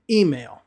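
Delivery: EE-mail, weight on the EE of email; stress on first syllable. Shown as a demonstration. Teacher showing learners email stressed at the beginning, "EE-mail". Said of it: "the stress will be at the beginning".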